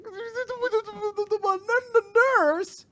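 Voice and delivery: hammed-up flustered yelp; mock flustered shock. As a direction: stammer up into a high, loud squeak. Flustered boy sees nurse outfit.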